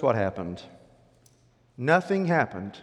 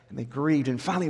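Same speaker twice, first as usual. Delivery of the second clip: normal talking voice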